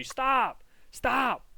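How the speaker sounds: high-pitched mock yell to stop; imitated yelling. Onlookers yelling at the speaker over a kid held down, imitated high yell. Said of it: "everyone's yelling at me".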